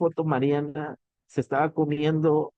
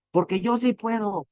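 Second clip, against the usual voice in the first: raised, higher mock shout of self-belief; retold shout; 6 semitones higher than usual. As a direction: shout it like a pumped-up CEO closing a training. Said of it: "y empieza a gritar". (imitando un grito de confianza, más agudo)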